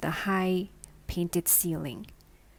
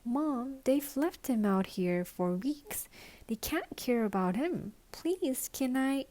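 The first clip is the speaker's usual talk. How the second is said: put-on higher, wheedling little girl; a small girl begging her mother to keep the muddy bunny; pleading little girl; 5 semitones higher than usual. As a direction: beg mom to keep the bunny.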